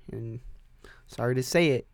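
normal talking voice

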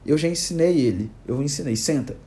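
usual speaking voice

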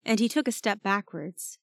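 ordinary delivery, nothing marked

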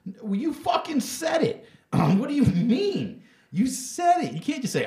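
high, loud, exasperated yell; outraged shout. Real, not acted. Ranting to his cohost about the apology, outraged shout. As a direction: blow up at the hypocrisy.

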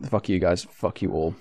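soft, low muttered curse; muttered quietly. Real, not acted. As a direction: mumble it under your breath. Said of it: "you said that wrong".

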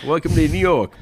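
loud, high New Yorker; loud brash New York greeting; New York accent. Pretend.

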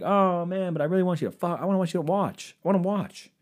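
mock friend. Comic mimics a pushy friend, whiny plea. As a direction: ham up the pleading pal. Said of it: "he would say some stupid shit like".

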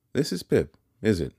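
put-on high, haughty young lady's voice; proud young lady. A narrator's reading. Young lady sizing up Pip at the gate, haughty. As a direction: look down on him, pitched well up. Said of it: "seemed very proud".

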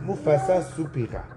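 ordinary delivery, nothing marked

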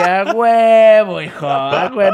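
put-on loud, slow, drawn-out cheer in a Chilango accent; slow, loud Chilango victory cry; triumphant shout. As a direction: yell it like a proud Mexico City local. Proud shout to fellow chilangos.

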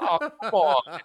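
put-upon movie victim, played for laughs; exasperated protest. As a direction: protest through laughter, imitating the character. Acted doomed character's exasperated line.

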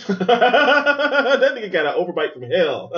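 high, loud and drawn out through laughter; laughing. Real, not acted. Viewer mocking a movie character's overbite, laughing. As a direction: crack up while saying it.

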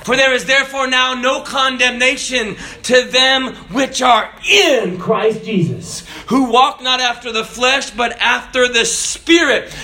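high, driving shouted sermon; impassioned preaching. Acted. Preacher proclaims God's word to the congregation, impassioned shouted preaching. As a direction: preach at full cry, stretching the key words.

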